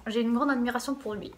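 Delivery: usual speaking voice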